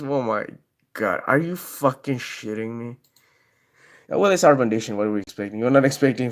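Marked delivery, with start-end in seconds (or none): none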